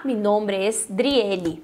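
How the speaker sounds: each word of mi nombre es Driele spelled out slowly; slow careful Spanish. Shown as a demonstration. Teacher slowly modelling an introduction for students. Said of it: "pra me apresentar".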